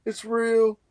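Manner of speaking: can't believe the Jordan card finally arrived; excited disbelief. Retold or imitated. Opening the long-awaited card envelope.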